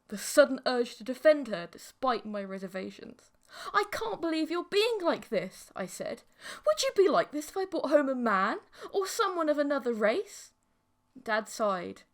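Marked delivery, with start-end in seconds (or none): 3.7-5.7 higher, louder protest; indignant. Acted. Son defends girlfriend. 6.7-10.5 louder, higher accusing question; heated challenge. Acted. Son argues with father.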